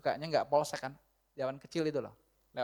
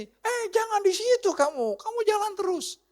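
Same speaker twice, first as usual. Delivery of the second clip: very high, loud phone order; bossy yelling; 7 dB louder than usual. Acted. Husband telling the driver not to stop there, bossy yelling. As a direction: shout insistent orders at a lost driver.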